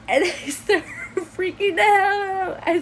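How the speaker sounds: high, loud burst through laughter; laughing. Real, not acted. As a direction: break up laughing mid-sentence. Laughs telling her own panic.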